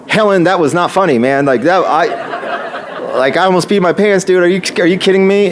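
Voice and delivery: high, worked-up retold protest; mock indignation. Storyteller to friend outside the bank, indignant protest. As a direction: act out complaining to a friend after a scare. Acted complaint to friend after scare.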